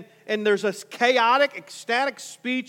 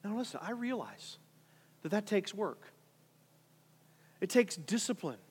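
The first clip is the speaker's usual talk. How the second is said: quiet aside; 11 dB quieter than usual. Real, not acted. Preacher quietly confides to new believers, softer. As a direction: drop the voice, softer, confiding with the church.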